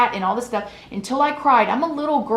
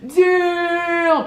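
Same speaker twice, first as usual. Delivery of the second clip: mocking shout; 8 semitones higher, much slower than usual. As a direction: shout it slowly for the crowd to laugh. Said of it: "He was like".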